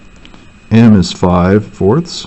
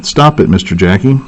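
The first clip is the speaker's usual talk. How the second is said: ordinary talk, nothing marked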